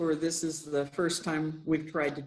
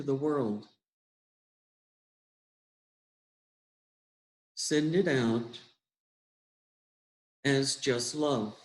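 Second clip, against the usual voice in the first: guided meditation; 2 semitones lower than usual. Acted. Leader guiding listeners into breathing and love, unhurried meditation voice. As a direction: guide them gently inward, phrase by unhurried phrase.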